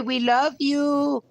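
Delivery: slow sing-song love to the dog; doting pet talk. Real, not acted. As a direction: croon it to the dog. Tender words to dog.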